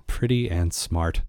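low, slow, dreamy; lovesick admiration. Real, not acted. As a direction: savour each word like a crush. Swooning over the Baroness.

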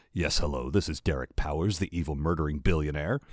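a hint of a smarmy villain on the phone; oily blackmailer purr. A narrator's reading. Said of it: "a smooth, smarmy voice".

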